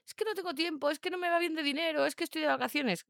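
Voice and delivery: high, quick run of mimicked excuses; mock excuse-making. Mimicking owners' list of excuses.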